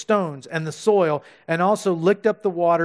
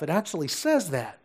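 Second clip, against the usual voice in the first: ordinary talk, nothing marked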